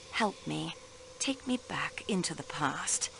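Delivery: whispered coaxing; detective softly leading a countess into memory. Acted. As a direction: breathe it, drawing her back.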